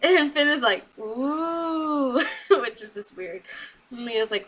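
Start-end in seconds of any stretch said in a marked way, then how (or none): 1.0-2.2 drawn-out, higher "ooh"; mock-impressed oooh at a dare; long taunting ooh; teasing ooh. Acting out Finn's teasing reaction.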